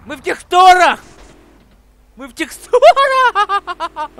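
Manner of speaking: high, loud amazed yell; excited shout. Real, not acted. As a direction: shout it out in disbelief. Excited disbelief at glitch.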